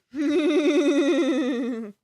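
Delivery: rapid-fire chuckle; real laugh. Real, not acted. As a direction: laugh at your own blunder. Laughs at own mistake.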